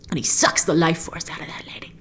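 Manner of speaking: hushed dramatic whisper. Acted. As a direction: whisper it like a spooky secret. Dramatic narration of the kill.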